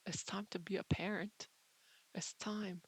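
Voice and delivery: hushed whisper. Pretend. Vlogger whispering solemn advice to viewers.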